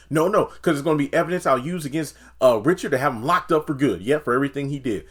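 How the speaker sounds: fast, loud ranting imitation; hammed-up furious rant; mock angry Nancy. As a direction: rattle it off in a fury.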